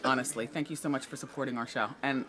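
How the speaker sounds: ordinary talk, nothing marked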